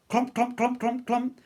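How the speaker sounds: heavy clomping. Acted. Footstep sounds.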